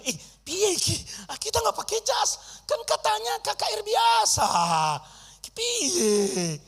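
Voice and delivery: high, startled and fussing; flustered surprise. Retold or imitated. Preacher panics on seeing suited parking attendant, high and startled. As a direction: panic over wearing no suit.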